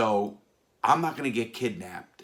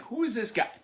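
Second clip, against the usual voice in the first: baffled. Retold or imitated. Bewildered reaction.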